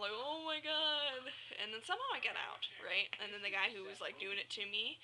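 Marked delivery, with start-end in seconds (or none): 0.1-1.3 higher, long drawn-out mock panic; retold freaking out. Dreamer retelling being trapped with blades, mock freak-out. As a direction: imitate freaking out, stretching the words slowly. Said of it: "I started freaking out".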